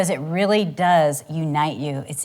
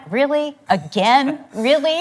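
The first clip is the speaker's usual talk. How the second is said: higher, incredulous "really, again"; mock exasperation; 8 semitones higher, slower than usual. Wife mocking her own exasperation at her husband's answer, incredulous. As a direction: roll your eyes at the same old answer. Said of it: "I'm like".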